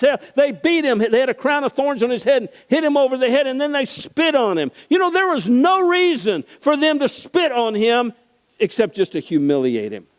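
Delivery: high, loud impassioned preaching; preaching at full force. Acted. Preacher retelling the soldiers' mockery with full force. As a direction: drive the story with fire.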